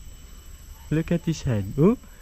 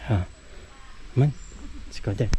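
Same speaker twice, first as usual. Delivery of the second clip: ordinary talk, nothing marked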